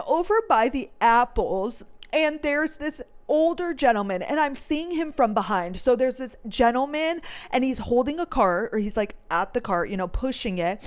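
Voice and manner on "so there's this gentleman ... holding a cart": ordinary delivery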